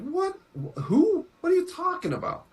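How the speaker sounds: high, bewildered squeak; baffled high-pitched what; confused. Retold or imitated. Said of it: "It's like".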